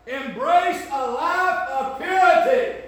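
high, loud, slow preaching cry; preaching at full force. Real, not acted. Preacher calling young people to purity, full-force shout. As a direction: ring it out over the congregation.

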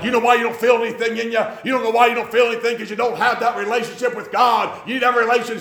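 high, loud, fast revival preacher; mock camp-meeting preacher. Camp preacher pressing the young lady to feel God, loud mock revival call. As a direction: push the crowd hard like a camp preacher, mocking. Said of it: "they're like".

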